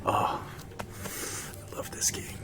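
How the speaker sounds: slow breathy whisper of joy; whispered delight. Real, not acted. Gamer relishing the game, slow whispered delight. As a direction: sigh it out lovingly, barely voiced.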